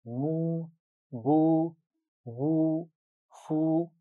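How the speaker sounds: मू, बू, वू, फू each with the oo held long; drawn-out oo. Shown as a demonstration. Teacher demonstrates long oo to students: drawn out. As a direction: stretch every oo. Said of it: "पेश की आवाज़ को लंबा कर देंगे".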